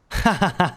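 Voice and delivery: high booming laugh at a friend; jovial mocking laugh; hearty laugh. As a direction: laugh him off, loud and high.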